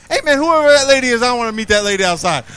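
fast, loud, teasing challenge shouted out; loud joking call-out. Real, not acted. Preacher jokes loudly about a lady making noise outside. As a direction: bark it out fast and playful.

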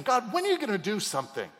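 high, fast desperate plea; pleading cry. Retold or imitated. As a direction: cry out to God impatiently. Prophet crying out to God.

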